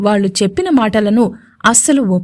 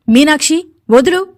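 sharp command; 4 semitones higher than usual. Acted. Gowri orders Meenakshi to let go.

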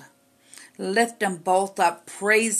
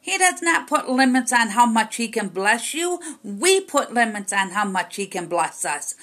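rising, louder pulpit preaching; fiery preaching; 4 semitones higher, 3 dB louder than usual. Acted. Preacher rebuking the congregation for limiting God, forcefully.

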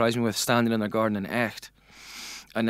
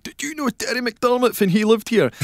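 high-pitched, pestering reporter voice; imitated tabloid journalist; 11 semitones higher, 4 dB louder than usual. Glasgow journalist flagging villagers down, high imitated voice. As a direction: mimic a reporter accosting strangers, voice raised high. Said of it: "flagging people down in Pit Medin".

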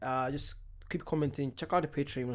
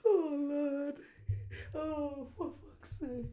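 helpless laughter; 13 semitones higher, 7 dB quieter, much slower than usual. Real, not acted.

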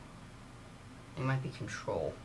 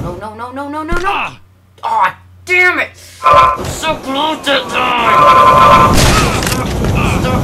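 high, loud, slow drawn-out wail; anguished wail; 10 semitones higher, 15 dB louder, slower than usual. Real, not acted. Gamer failing just short, wailing loudly. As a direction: howl at the near miss. Rage after failing level.

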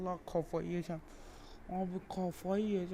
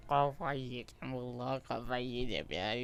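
exasperated groan; 6 semitones lower than usual. Real, not acted. Worn down by the game.

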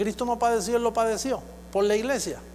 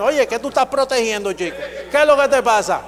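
raised, scolding voice; mock rebuke; 4 semitones higher, 6 dB louder than usual. Scolding a lazy churchgoer, raised mock rebuke. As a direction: chide him loudly, half joking.

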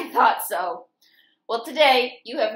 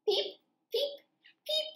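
high soft little peeps; chick peeps; 6 dB quieter than usual. Acted. Reading to kids, chicks peeping high and soft. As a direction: peep like a tiny chick.

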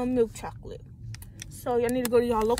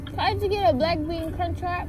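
repeating louder; 6 semitones higher than usual. Real, not acted. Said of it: "What was that?".

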